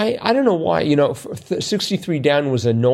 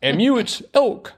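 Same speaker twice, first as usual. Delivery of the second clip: put-on a playful, amateur Australian accent pitched higher; hammed-up Australian accent, rising higher by the end; Australian accent attempt; 8 semitones higher than usual. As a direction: have a go at an Australian accent, half-joking. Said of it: "that was my, uh, Australian accent".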